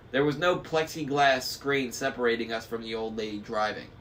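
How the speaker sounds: ordinary delivery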